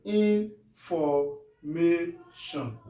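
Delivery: Information, drawn out very slowly; slow model pronunciation of Information; slow demo word. Shown as a demonstration. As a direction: stretch Information syllable by syllable. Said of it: "easier for us to spell".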